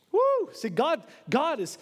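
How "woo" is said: sharp, loud, high woo; one excited "woo!"; short whoop. Real, not acted. Preacher's own exclamation.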